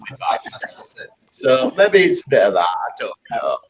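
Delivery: usual speaking voice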